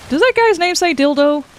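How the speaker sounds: sudden high disbelieving question; surprised. Real, not acted. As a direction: blurt it out startled.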